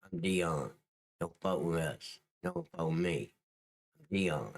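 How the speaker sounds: low-pitched mock gangster warning. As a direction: play a tough gangster with a low menace. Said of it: "that sounds like a gangster name".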